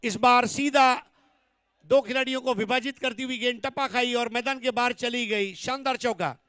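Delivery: loud, high boundary call; excited cricket commentary. Real, not acted. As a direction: belt out the straight four.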